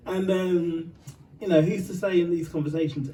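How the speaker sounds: ordinary talk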